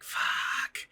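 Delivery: breathy under-the-breath whisper of dismay; whispering a curse while reading the book. Retold or imitated. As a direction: whisper it to yourself, caught out.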